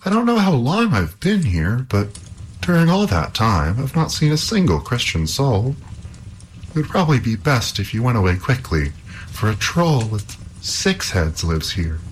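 high, anxious princess. Acted. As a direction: plead with him as a frightened princess. Princess warns Halvor.

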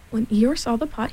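plain reading voice

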